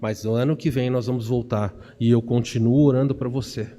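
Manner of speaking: tender, subdued sympathy; understanding husband. Retold or imitated. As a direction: reassure a grieving wife, low and unhurried. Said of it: "demonstrar compreensão e falar assim".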